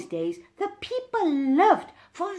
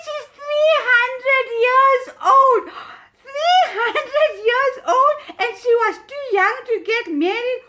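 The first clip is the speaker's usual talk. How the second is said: excited punchline; 8 semitones higher, 7 dB louder than usual. Acted. Joke punchline about age.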